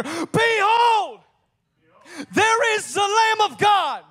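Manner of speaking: very high, loud, ringing cry; prophetic shout. Acted. Wild man announcing the Messiah to listeners, shouting. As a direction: shout the announcement over a crowd. John's cry acted out again.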